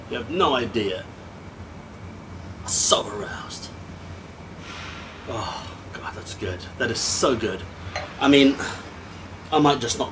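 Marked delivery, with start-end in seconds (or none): 2.7-3.7 slow, hushed mock-aroused whisper. Reviewer savouring the dram, slow whisper. 5.3-6.6 much softer, lower murmur of pleasure; hushed. Real, not acted. Reviewer tasting the whisky, very soft murmur. Savouring the taste.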